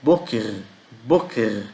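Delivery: "boker" as BÔ-ker, falling on "ker"; "boker" said slowly as a model, first syllable strong; stress on first syllable. Shown as a demonstration. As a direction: hit the "bo" of "boker" each time. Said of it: "A sílaba tônica é no B".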